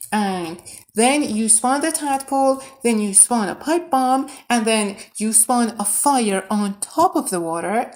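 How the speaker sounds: normal talking voice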